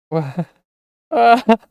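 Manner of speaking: high mocking giggle that breaks out; villain giggle. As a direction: laugh at her tears like a cruel imp. Villain laughs at Sarah.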